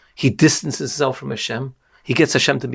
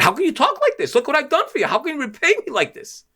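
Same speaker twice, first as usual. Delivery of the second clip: indignant rebuke; 12 semitones higher than usual. Retold or imitated. Host snaps back at ungrateful guest.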